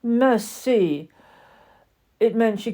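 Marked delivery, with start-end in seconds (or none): 0.0-1.1 higher, louder French cry; a touch of haughty French; French exclamation. Acted. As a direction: toss it off like a grand French lady. French doll reacts.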